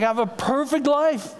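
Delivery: high, hammed-up wonder; imitating a loopy patient marvelling; kooky bliss. Groggy son repeated for the congregation, kooky bliss. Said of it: "obviously a little kooky".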